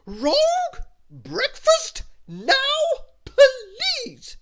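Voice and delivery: very high full yell, words drawn out; shrieked, slow bossy command; Little Miss Bossy. A narrator's reading. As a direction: yell the order word by word. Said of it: "yelled Little Miss Bossy".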